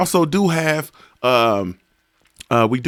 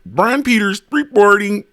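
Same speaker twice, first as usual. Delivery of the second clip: higher, stretched mock reporter voice; news reporter sign-off; 5 semitones higher than usual. Streamer playfully ribbing a viewer, mock reporter sign-off. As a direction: play the correspondent, drawing out "reporting". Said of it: "I'm just playing".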